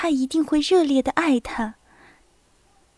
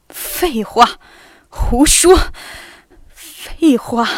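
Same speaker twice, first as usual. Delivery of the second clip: 响亮地连声斥责; 不耐烦地把话一口否定; 皱眉大声斥责; 4 dB louder, much slower than usual. Acted. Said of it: "皱着眉大声地说". (皱着眉大声呵斥废话)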